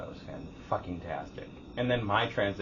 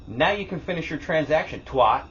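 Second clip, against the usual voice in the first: loud, raised-pitch retort with a punched last word; acted parting jab; 8 semitones higher, 8 dB louder than usual. Customer gets back at rude lady, in a loud acted retort. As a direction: throw the insult back, loudly.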